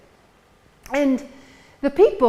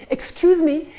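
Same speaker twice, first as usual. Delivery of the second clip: polite pleading; 6 semitones higher, 5 dB louder than usual. Acted. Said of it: "crawled through the people and saying".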